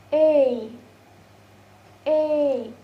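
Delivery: "a" as in day, a long falling glide; diphthong demo. Shown as a demonstration. Teacher showing the vowel in day, drawn-out demo. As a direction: model "a" slowly, as the vowel in day. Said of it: "And the next is".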